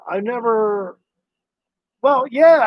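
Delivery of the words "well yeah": flustered yeah. Real, not acted. Flustered answer to chat question.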